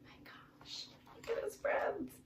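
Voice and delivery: hushed aside to listeners; whispered amazement. Real, not acted.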